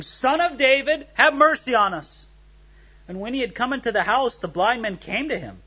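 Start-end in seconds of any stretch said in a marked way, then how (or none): 0.0-2.3 higher-pitched plea read aloud; crying out. A narrator's reading. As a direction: read it as a raised cry for mercy. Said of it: "crying out and saying".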